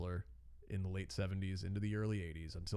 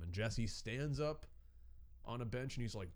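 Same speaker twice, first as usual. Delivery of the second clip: normal talking voice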